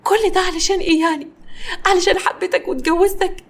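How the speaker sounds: high, tearful put-on wail; hammed-up sobby complaint, pitched high; fake crying. A narrator's reading. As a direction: play the weepy victim to your husband. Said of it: "كارمن بدأت تعيط بتمثيل".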